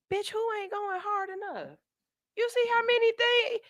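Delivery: high, incredulous snap-back; indignant clapback. Real, not acted. Host snapping back at her own harsh inner voice. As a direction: defy your inner critic, mock-offended.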